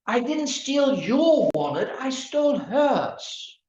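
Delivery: "your" stressed with a rise. Shown as a demonstration. Lecturer contrasting meanings by stressing "your". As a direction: lean on "your", slow on "I stole hers". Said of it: "It's the same sentence".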